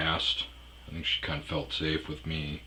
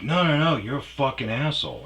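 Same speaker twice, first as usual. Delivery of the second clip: higher, louder indignant bartender; insistent mock scolding; 9 semitones higher, 8 dB louder than usual. Imitating bartender rejecting his long story, insistently indignant. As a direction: cut off the excuse and scold loudly.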